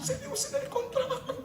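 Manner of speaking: very high put-on tearful whine; imitating crying plea. Returning mother fakes tears to the ustadz again, high mock sobbing whine. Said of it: "Nangis lagi".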